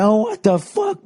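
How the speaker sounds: high, slow, outraged cry. Retold or imitated. Kid flipping out at the friends giving away money, high and outraged. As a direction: throw your hands up and howl it. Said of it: "throwing his hands up".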